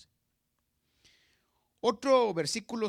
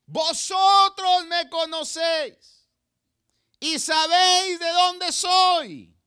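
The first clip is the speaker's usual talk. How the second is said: grita muy alto y lento, con toda la fuerza; proclamándolo a gran voz al templo; grito fuerte; 8 semitones higher, 10 dB louder than usual. Acted. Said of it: "Recuerda, está gritando". (gritando a gran voz)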